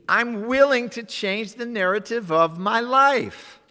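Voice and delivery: loud, slow, high preaching. Acted. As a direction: proclaim each affirmation. Preaching affirmations at full force.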